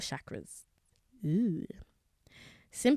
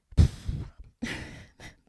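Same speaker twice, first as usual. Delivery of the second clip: tired sigh; 3 dB louder than usual. Real, not acted. Overwhelmed by episode.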